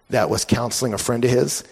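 normal talking voice

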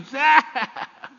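high, loud mock shriek of laughter; imitated toddler laugh. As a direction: burst out like a tickled toddler. Imitates the nibbled grandson's reaction.

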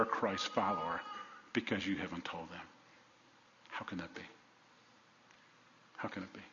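soft, low, grave pleading with long pauses; hushed, heavy-hearted preacher's challenge; solemn appeal. Acted. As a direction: let each question sink in quietly. Solemn reading of author's appeal.

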